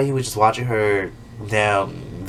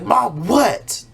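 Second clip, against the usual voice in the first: higher, sharp mom what; snappy reply; 8 semitones higher than usual. Retold or imitated. Miranda snapping at her mom, higher and rude. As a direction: snap back at mom. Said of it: "then she's like".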